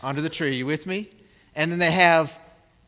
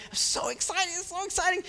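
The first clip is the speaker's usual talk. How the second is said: put-on excited child; 12 semitones higher, 6 dB quieter than usual. Child voiced tearing open present.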